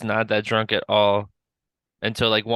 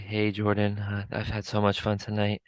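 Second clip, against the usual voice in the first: a bit softer, drunk friend impression; 6 dB quieter than usual. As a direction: imitate a happy drunk buddy. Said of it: "what's his drunk voice?".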